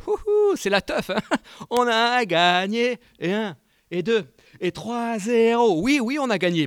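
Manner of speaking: whooping, high and loud, slowed chant of victory; mock party cheer. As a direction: ham up a fan cheering a win. Mocking Corinthians partying, celebrating victory. (imitation moqueuse de fêtards, criée et scandée)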